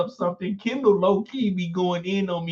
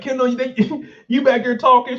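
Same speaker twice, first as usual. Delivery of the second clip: higher, louder teasing imitation; mock questioning; 9 semitones higher, 5 dB louder than usual. Classmates catching Kendall whispering, mock questioning. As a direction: call out a pretend accusation with a laugh. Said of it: "They're like".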